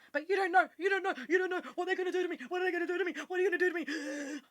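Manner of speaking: high, fast, frightened pleading; panicked inner voice. Retold or imitated. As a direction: let the panic run away with you, high and quick. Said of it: "my nervous system's going".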